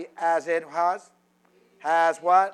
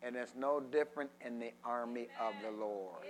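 usual speaking voice

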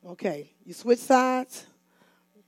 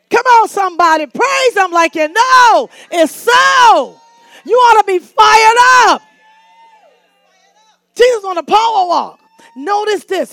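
high, shouted exhortation; loud, high revival shout; full-force preaching; 9 semitones higher, 16 dB louder than usual. Real, not acted. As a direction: fire up the congregation at full volume.

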